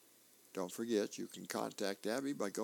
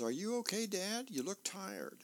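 higher, concerned grown daughter voice; daughter's voice; 9 semitones higher than usual. Acted. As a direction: pitch it up as the worried daughter. Daughter voiced in comic strip.

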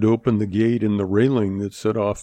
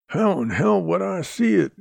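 understated, indignant growl with a higher pitch; growled retort; 9 semitones higher than usual. A narrator's reading. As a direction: throw the question back, mildly irritated and higher. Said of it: "Shorty growled".

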